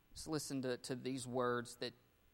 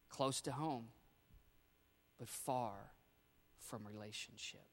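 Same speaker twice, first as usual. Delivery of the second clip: soft refrain; 3 semitones lower, 7 dB quieter than usual. Real, not acted. Refrain repeated softly.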